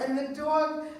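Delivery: usual speaking voice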